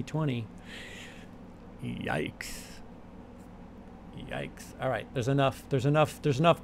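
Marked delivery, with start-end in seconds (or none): 1.8-4.6 Yikes breathed as a low, much softer whisper; a hushed, voiceless Yikes. Shown as a demonstration. Repeating his catchphrase.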